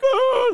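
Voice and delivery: high drawn-out mock cry; imitated crying, one long high note; imitated child's wail. Daughter hiding her hurt eye, mock wailing. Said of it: "she's crying".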